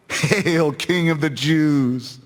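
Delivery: slow, drawn-out mock salute; lightly jeering, stretched-out greeting. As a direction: hail him with scornful ceremony. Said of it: "mocking him".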